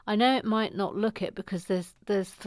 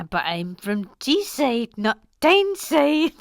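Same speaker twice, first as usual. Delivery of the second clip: botched Newcastle attempt, jumping louder at the end; woeful Newcastle accent; 4 dB louder, slower than usual. Shown as a demonstration. Host attempting a viewer's accent, bad Newcastle. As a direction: try Newcastle and overdo the last word. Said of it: "my attempt at a Newcastle".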